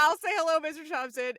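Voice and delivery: calling out loud and bright; loud mock greeting. To her cohost, quoting the Thompson greeting loudly. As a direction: boom the greeting out. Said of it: "they're like".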